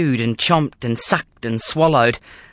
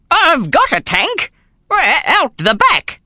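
put-on proud old man; 13 semitones higher, 4 dB louder than usual. Gramps voiced, confused boast.

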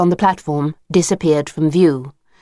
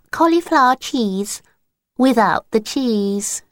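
higher, earnest "Cauliflower cheese without the cheese", slowing at the end; corrected password attempt; 6 semitones higher than usual. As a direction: try to fix the password with a straight face. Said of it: "can't even say the password properly".

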